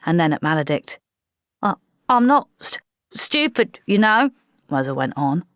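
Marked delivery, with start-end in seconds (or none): 1.6-4.3 stammering. Acted. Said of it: "red in the face and trembling".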